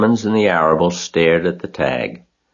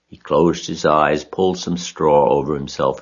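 ordinary delivery, nothing marked

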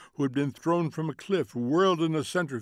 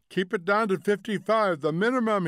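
raised, higher-pitched shout; 8 semitones higher, 5 dB louder than usual. A narrator's reading. Husband to wife driving too fast, urgent shout. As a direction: shout it over a screaming engine. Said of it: "he shouted".

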